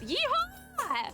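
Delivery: high, long whooped yeehaw; whooping cowboy cheer. Real, not acted. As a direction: let out a gleeful rodeo whoop. Cheers at western outfit.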